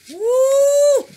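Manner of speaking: very high, loud whoop of delight; excited whoop. Real, not acted. Crafter revealing her resin piece to viewers, excited whoop. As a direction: let out a thrilled squeal.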